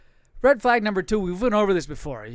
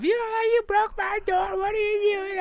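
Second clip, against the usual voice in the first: high, slow mock wife voice, much higher; mimicking angry wife. Husband mimics his wife yelling about the door, high mock shriek. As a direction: squeal it like the upset wife. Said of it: "she was going off".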